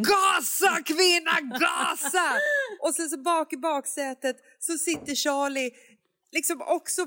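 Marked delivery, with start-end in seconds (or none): 0.0-2.3 higher mock shout; skrik. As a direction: bark at the driver to speed up. Skriker åt mamma. (skriker åt mamman att gasa, högre)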